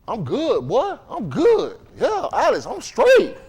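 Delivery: high, swooping mock voice; mocked neighborhood acquaintance. Neighborhood acquaintance brushing off the preacher, high mocking imitation. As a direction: imitate him with pitch leaping way up.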